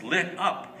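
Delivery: ordinary delivery, nothing marked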